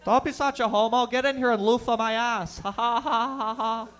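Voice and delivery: high, loud dopey jock voice laughing in the locker room; mock a locker-room bully from Barrie; Barrie hockey bro accent. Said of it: "That's a Barrie accent".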